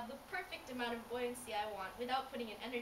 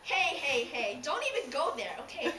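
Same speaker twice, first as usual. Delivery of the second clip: louder, quick defensive hey hey hey; indignant protest; 3 semitones higher, 8 dB louder than usual. Acted. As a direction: push back hard, half joking. Fish defends himself against insult.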